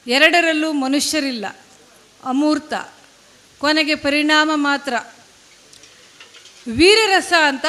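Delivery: measured phrases with deliberate pauses between them; poetry recitation. Acted. Poet reading a poem on war and music to an audience, recitation.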